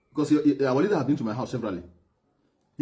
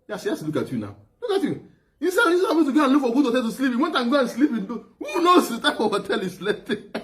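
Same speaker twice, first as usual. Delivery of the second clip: laughing hard; 8 semitones higher than usual. Real, not acted. Retelling the hotel story while laughing so hard it brings tears. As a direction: crack up while telling it. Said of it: "I'm tearing up because, uh, when I laugh hard".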